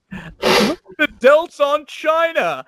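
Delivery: high, loud, drawn-out commentator yelp; Vince McMahon impression. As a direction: exclaim it slowly in amazed, high-pitched hype. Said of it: "Vince says".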